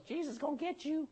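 high, soft parrot call. Acted. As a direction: squawk it quietly like a talking parrot. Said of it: "this parrot goes".

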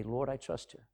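hushed, earnest prayer; a quiet daily vow of trust in God; soft prayer. Shown as a demonstration. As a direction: murmur "Lord, I trust you" softly, falling away. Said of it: "Trust is a decision that you need to make every day".

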